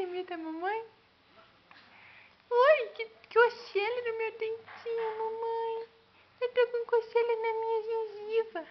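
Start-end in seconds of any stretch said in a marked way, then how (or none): 2.5-8.7 put-on high, squeaky baby voice. Mother voicing teething baby. (voz de bebê aguda)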